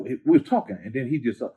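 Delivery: normal talking voice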